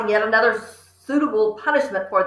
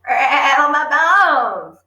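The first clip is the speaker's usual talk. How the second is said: slow, high, eerie bird croak; spooky parrot voice; 4 semitones higher, 5 dB louder, much slower than usual. Acted. Parrot showing off its trick to the children, spooky and slow. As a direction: draw it out like a ghostly parrot. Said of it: "a wonderful imitation of a spooky voice".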